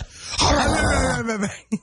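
a high, gobbling cookie-monster growl through a full mouth; a mock Cookie Monster chomp; Cookie Monster munch noise. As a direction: mimic someone cramming a whole bar in. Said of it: "and she jams the entire bar into her mouth and goes".

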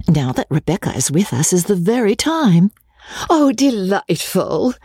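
put-on high, excited young ladies' voices; eager delight. A narrator's reading. Girls eager for Vauxhall outing.

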